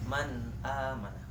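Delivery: "man amana." read very slowly and clearly; slow model reading. Shown as a demonstration. Teacher repeating "man amana." slowly for students. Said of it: "Sekali lagi".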